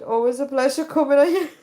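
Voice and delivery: high, giggly reading; real laughter on the misread line; laughing. Real, not acted. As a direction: read it laughing.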